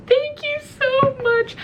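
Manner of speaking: very high, slow tearful squeak; mock crying. Thanking the fireman for helping, high mock crying. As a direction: wail your gratitude in a squeaky voice. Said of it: "I'm still crying a little bit".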